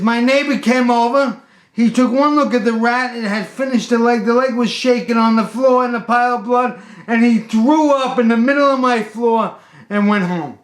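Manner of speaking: high, loud frantic retelling; worked-up mock-hysterical shouting; hysterical report. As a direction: imitate a panicked man recounting a disaster. Hysterical customer recounting disaster.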